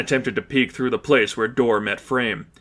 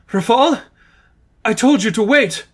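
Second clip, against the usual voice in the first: startled nun; 11 semitones higher, 5 dB louder than usual. Acted.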